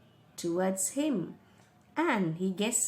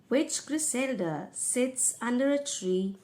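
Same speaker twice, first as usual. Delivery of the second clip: ordinary talk, nothing marked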